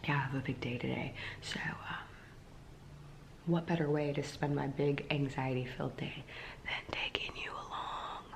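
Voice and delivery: hushed, half-whispered chat; talking quietly. Real, not acted. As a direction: keep it soft for someone still sleeping. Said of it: "I'm talking quietly 'cause Joff's sleeping".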